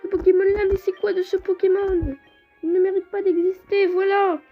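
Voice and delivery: raging gamer. Real, not acted. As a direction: rant at the useless Pokémon. Outraged at useless Skitty.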